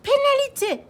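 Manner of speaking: very high loud referee-style shout; hockey player yelling. Acted. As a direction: call the penalty at full voice. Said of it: "hurle le joueur de hockey". (le joueur hurle «Pénalité!»)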